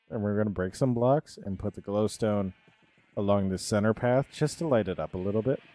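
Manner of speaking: ordinary talk, nothing marked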